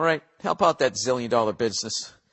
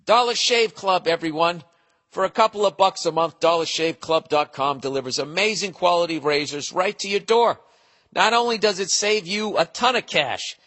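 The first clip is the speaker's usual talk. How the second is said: upbeat ad read, a bit higher; 4 semitones higher than usual. Acted. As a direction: sell it like a radio spot. Reads the sponsor ad.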